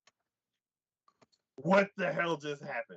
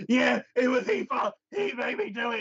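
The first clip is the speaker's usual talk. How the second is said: high, loud put-on woman voice; hammed-up whiny woman; mock blaming woman; 10 semitones higher, 4 dB louder than usual. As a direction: blame him in a high whiny voice. Voicing woman blaming husband.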